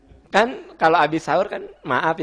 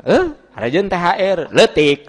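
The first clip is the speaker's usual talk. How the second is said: loud, raised-pitch complaint; mock grumbling wife; 4 semitones higher, 7 dB louder than usual. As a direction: play a wife scoffing at a small bonus. Acts wife grumbling at small bonus. (istri menggerutu, dibuat-buat)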